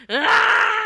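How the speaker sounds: high, loud anguished scream; cry of anguish. Acted. Keeper defeated by new ideas, loud anguished scream. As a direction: let out a piercing wail. Tower Keeper breaks apart crying out.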